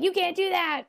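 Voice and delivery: high-pitched mock protest; lightly voiced villain whining in objection; Mister Bean. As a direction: pitch it up, indignant but playful. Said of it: "So Mister Bean's like".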